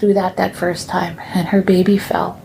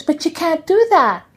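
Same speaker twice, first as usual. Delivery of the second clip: put-on child protesting; 8 semitones higher than usual.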